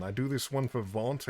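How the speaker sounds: normal talking voice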